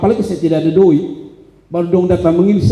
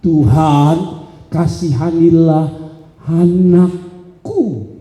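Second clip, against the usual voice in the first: very slow, drawn-out plea; pleading parent; much slower than usual. Acted. Parent begging the Lord for mercy on a child, slow plea. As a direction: stretch each word out as you beg. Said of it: "Dia bilang begini, katanya".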